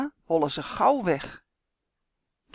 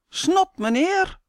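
much higher, slow cheeky call; cheeky shout; 12 semitones higher, 5 dB louder, slower than usual. A narrator's reading. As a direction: taunt the neighbour. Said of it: "roept hij". (brutaal roepen, hoog en langzaam)

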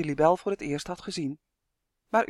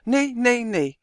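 louder, stubborn no after no; defiant refusal; 5 semitones higher, 6 dB louder, slower than usual. A narrator's reading. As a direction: dig your heels in, refusing. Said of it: "dacht hij opstandig". (koppig en luider: nee, nee, nee)

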